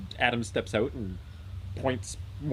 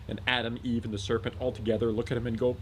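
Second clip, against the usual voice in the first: usual speaking voice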